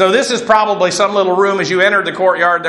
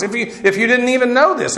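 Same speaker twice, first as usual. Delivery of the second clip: ordinary delivery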